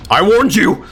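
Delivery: high, loud hammed-up threat; mock war cry. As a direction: roar it like a vengeful hero.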